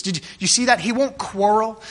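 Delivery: ordinary talk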